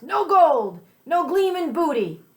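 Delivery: high, loud cry of disappointment; pirate's frustrated cry. Acted. Pirate finding only clean teeth, crying out high and loud. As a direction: wail it like a cheated pirate.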